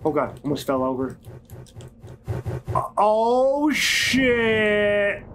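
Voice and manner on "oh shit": sudden startled cry. Real, not acted. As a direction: jump at the fright. Sudden scare in game.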